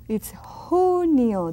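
whispered slow "It's junio". Shown as a demonstration. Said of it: "That J is like an H sound".

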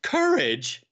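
high-pitched incredulous echo; astonished cry. A narrator's reading. Nephew echoing his uncle's word, crying out. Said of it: "I cried".

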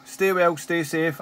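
ordinary talk, nothing marked

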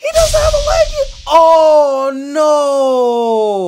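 high, loud shocked gasp of a question; stunned realization. Real, not acted. Realizing the boy also lost a leg, high shocked cry. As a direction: cry out as it all clicks.

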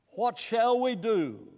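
slow, loud and high, begging for an answer; pleading cry. Retold or imitated. The Pentecost crowd cries out to Peter, loud and pleading.

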